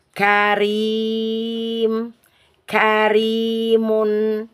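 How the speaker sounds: karim with a light, thin r; tipped light r in karim. Shown as a demonstration. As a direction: say karim with the r thin, tongue flat. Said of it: "Ini ro dibaca tipis".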